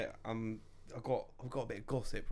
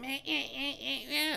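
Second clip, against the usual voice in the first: high mocking meh-eh-eh bleats; dismissive meh noises; 13 semitones higher than usual. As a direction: bleat it off dismissively, much higher. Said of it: "it's like".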